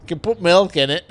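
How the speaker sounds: imitated waitress. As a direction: play the waitress offering an alternative, loudly. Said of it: "I can't do the accent, but she's like".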